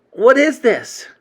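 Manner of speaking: put-on uneasy kid demanding an explanation; demanding boy. A narrator's reading. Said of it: "he said".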